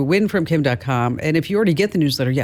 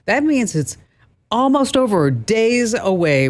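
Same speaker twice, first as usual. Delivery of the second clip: excited tease; 7 semitones higher than usual. Acted. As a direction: voice jumps much higher, then days away drawn out slowly. Host teasing iPhone giveaway ending.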